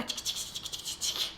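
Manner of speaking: whispered ticking like tiny bugs; playful ticking of bugs in the mouth; bug nibbling noise. Pretend. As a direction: tease the child with a creepy-crawly sound.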